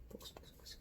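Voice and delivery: whispering to herself, urging the camera; a pleading whisper at the camera; whispered focus. Real, not acted. Muttering at the camera to focus, in a whisper.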